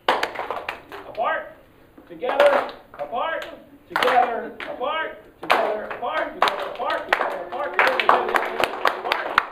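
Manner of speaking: loud, high rhythmic calling; cheerleader-style clap chant; clap rhythm calls. Shown as a demonstration. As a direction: pump up the crowd with each clap call. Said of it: "put your hand up like this".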